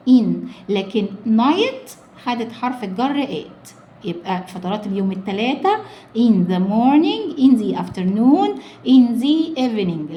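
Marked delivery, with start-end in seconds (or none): 6.2-10.1 slow, loud, leaning on "in"; stress on in. Shown as a demonstration. Teacher stresses preposition "in" for pupils. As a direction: hit "in" each time. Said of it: "خدوا حرف الجر in".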